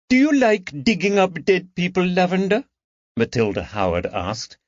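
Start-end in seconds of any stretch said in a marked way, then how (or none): 0.1-2.6 put-on high, teasing girl's voice, much higher; sly girl. Teenage granddaughter teasing the detective, high and sly. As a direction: slyly needle him from behind.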